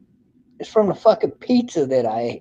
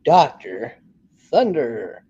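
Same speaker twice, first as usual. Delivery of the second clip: lower, drawn-out title announcement; deep announcer voice; 6 semitones lower, slower than usual. Real, not acted. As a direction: announce the name low and grand. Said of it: "And this is".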